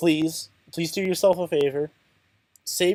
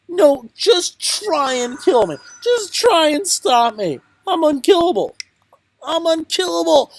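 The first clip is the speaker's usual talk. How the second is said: defiant boast; 12 semitones higher, 6 dB louder than usual. Acted. Boasting defiantly about surviving.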